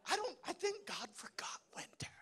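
a whispered confidential joke; hushed joking aside. Real, not acted. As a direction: whisper it like a secret to the crowd.

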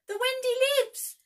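very high, loud joyful cry; cried out. A narrator's reading. Nibs cries out in relief that Wendy lives, high and loud. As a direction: burst out with relief. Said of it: "cried Nibs".

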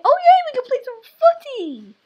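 very high, loud cheer; thrilled high-pitched shout; excited squeal. Real, not acted. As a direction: squeal with delight, holding it high. Delighted to find football pitch.

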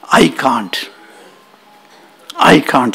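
low, mildly deflated voice; dejected admission. Acted. Agni returning to Indra with head down, dejected. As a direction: admit defeat, pitch sinking. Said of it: "he put his head down".

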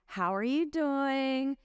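slow, drawn-out cheery hello; breezy bedside greeting. Retold or imitated. Doctor walking into the room, greeting the patient with slow mock cheer. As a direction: breeze into the room sounding casual. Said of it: "you walk in the room like".

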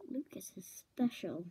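low whispered aside of a villain; scheming whisper. Acted. Villain privately plots to steal voices, whispering. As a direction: whisper the plot to yourself.

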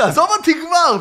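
shouting at the vendor to leave him alone, loud; retold shout. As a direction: yell it while running away. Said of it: "ואני צועק עליו".

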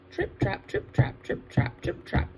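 crisp, measured trip-trap; medium goat hoofsteps. Acted. Medium goat starts across the bridge, crisp hoofsteps. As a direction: tap it out in an even rhythm like hooves.

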